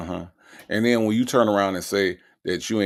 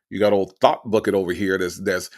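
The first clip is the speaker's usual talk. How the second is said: ordinary talk, nothing marked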